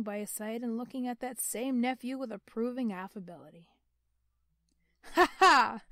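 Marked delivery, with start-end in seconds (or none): 5.1-5.8 loud, high ringing laugh; nephew's booming laugh; hearty laugh. Acted. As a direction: laugh out big and open. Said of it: "laughed Scrooge's nephew".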